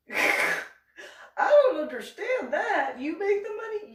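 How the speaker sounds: high, loud incredulity; hammed-up, high-pitched disbelief; baffled outburst. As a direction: wail it in mock bewilderment.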